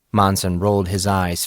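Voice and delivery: ordinary delivery, nothing marked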